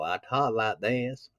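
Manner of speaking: imitating a thick, lazy Southern drawl. Shown as a demonstration. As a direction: stretch it out in a heavy Southern accent. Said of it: "I had a thick Southern drawl".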